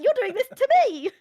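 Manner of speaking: very high, loud hammed-up wail; mock wail. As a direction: wail it like a dramatic teen, tongue in cheek. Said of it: "was like".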